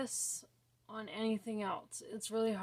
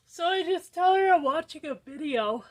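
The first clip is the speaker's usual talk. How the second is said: suddenly loud and high, blurting it out; accidentally too loud; 7 semitones higher, 11 dB louder than usual. Real, not acted.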